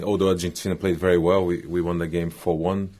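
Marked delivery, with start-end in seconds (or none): none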